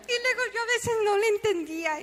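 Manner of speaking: laughter spilling into speech; laughing through words. Real, not acted. Still laughing while telling.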